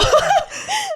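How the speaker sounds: high burst of laughter. Real, not acted. Narrator laughs at her own mess, high laugh.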